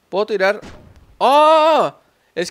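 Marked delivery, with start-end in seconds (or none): 1.2-1.9 loud, high "oh"; sudden loud gasped cry; startled yell. Real, not acted. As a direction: jolt of surprise. Sudden explosion nearby.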